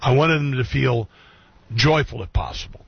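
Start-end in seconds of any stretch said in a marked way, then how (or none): 2.2-2.8 soft unvoiced afterthought; whispered aside. Real, not acted. Host adding a hushed aside to listeners, whispered. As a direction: drop to a breathy whisper.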